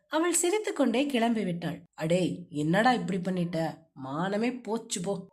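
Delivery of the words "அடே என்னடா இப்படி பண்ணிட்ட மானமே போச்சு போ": scolding himself. A narrator's reading. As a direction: lower hero voice, muttering self-rebuke. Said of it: "தனக்குத்தானே திட்டிக் கொண்டு இருந்தான்". (தன்னைத்தானே லேசாக திட்டும் ஆண் குரல்)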